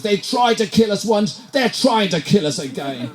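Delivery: high-pitched attempt at a Brazilian accent; bad Brazilian accent. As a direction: poorly imitate a Brazilian, pitched right up. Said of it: "I can't do a Brazilian accent".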